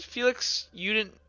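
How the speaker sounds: normal talking voice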